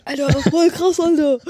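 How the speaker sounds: laughing mock slang voice; Berlin street slang. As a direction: giggle through the street-bro lingo. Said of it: "Ist halt Berlin".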